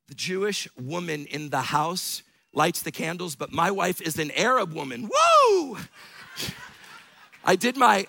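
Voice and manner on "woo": loud, high-pitched "woo"; high cheering whoop; excited whoop. Real, not acted. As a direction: let out a celebratory whoop. Whoop after mentioning his wife.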